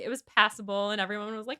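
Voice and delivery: ordinary delivery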